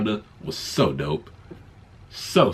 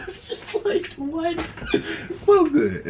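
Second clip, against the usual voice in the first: high laughter; slower than usual. Real, not acted. Cracking up at Groot line.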